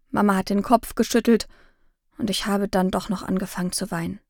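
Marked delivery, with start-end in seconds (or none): none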